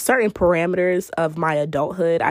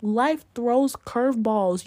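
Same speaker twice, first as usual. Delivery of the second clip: ordinary talk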